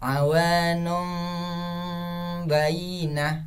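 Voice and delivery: chanted "awanum bainah" with measured hummed m; level chant, held m in "awanum"; correct iqlab recitation. Shown as a demonstration. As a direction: hum the m of "awanum", then "bainah". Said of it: "Tapi cara bacanya".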